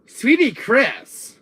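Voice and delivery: loud, high, drawn-out disbelief; incredulous yelp, slowly drawn out; indignant outburst. Acted. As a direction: stretch the name out in a high, loud cry. Indignant at hearing the nickname.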